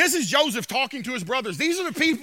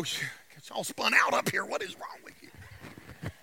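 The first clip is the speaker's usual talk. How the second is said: whisper; 5 semitones lower, 12 dB quieter than usual. Real, not acted. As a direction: mutter it away from the mic. Aside about the sound system.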